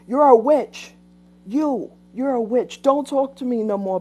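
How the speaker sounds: retold coworker shouting witch at her; mock angry yell. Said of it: "So she's screaming at me".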